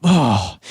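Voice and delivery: big imitated sigh; sigh of relief. Acting out girlfriend's relief at no dropped-knife mishap, imitated sigh. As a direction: mimic a huge relieved sigh. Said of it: "lets out this huge sigh of, like, you know, relief".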